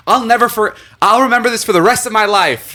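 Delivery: high, over-excited vow; retold gushing excitement. Excited teen to an unimpressed schoolmate, gushing. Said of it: "I was so excited about it".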